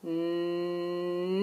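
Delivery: humming nnn for N; letter sound N. Shown as a demonstration. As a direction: hum the N sound. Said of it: "N sounds like this".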